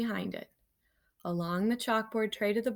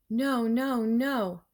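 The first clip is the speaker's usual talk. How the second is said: rising alarm, louder; growing panic; dismayed no; 3 semitones higher, 5 dB louder, much slower than usual. Acted. Discovers clipping upside down.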